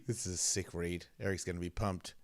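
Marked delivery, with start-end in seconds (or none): none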